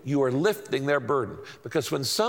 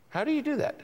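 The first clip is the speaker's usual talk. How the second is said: much higher, mock-bewildered asking; high-pitched, softer puzzled repeat; puzzled question; 11 semitones higher, 4 dB quieter, faster than usual. Shown as a demonstration. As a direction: play the confused listener asking how.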